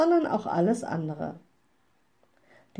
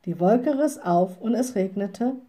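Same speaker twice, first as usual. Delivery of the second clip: ordinary delivery, nothing marked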